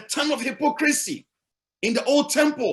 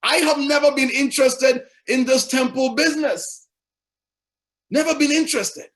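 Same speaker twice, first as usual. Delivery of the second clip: firm, louder declaration, rising at the end; voicing Jesus firmly; 4 semitones higher, 4 dB louder than usual. Preacher voicing Jesus to the congregation, firm and louder. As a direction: speak for Jesus, pushing it out. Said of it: "And Jesus Christ says".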